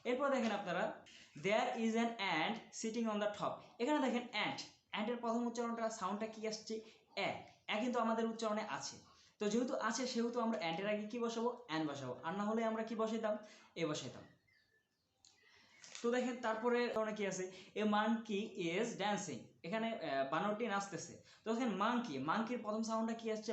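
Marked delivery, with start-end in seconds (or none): 1.4-3.5 each word drawn out for learners; slow, deliberate classroom reading; slow example reading. Shown as a demonstration. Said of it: "teacher reads example slowly". 17.8-19.4 every word stretched for learners; extremely slow classroom dictation; very slow example reading. Shown as a demonstration. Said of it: "teacher reads example slowly".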